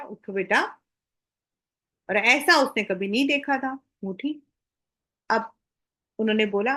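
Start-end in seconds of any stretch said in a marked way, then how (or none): 0.0-0.6 lower, gentle coaxing to get up; friendly suggestion. Retold or imitated. Teacher to a timid child, as a friendly suggestion. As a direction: ask it kindly, like an affectionate teacher. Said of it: "just a friendly suggestion".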